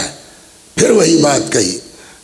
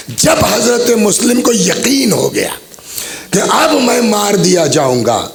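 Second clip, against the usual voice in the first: much higher grieving cry; rising lament; 7 semitones higher than usual. Acted. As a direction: cry it out to the mourners. Lament rises toward death.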